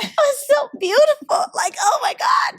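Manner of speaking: high, loud, hammed-up crying; mock sobbing. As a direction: wail it like a fan wrecked by a singer. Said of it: "just wrecked in the bedroom".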